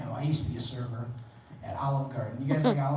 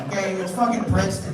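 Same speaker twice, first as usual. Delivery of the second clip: usual speaking voice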